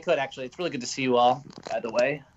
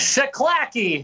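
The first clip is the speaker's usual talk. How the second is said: excited greeting; 9 semitones higher, 4 dB louder, much slower than usual. Real, not acted. Guest bursting in greeting.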